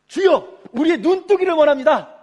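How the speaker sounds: high, very loud, full-force cry; shouted plea. Acted. Blind beggars answering Jesus's question, shouted plea. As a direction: cry out the request at the top of the voice without hesitating.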